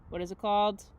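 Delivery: ordinary talk, nothing marked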